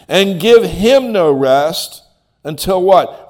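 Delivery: loud, slow scripture proclamation; preaching at full force. Acted. Preacher booming scripture to the congregation. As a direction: drive each phrase home slowly.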